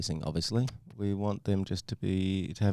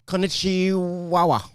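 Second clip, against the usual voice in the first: slow drawn-out punchline; 9 semitones higher, 5 dB louder, much slower than usual. Acted. Punchline of Japanese dog joke.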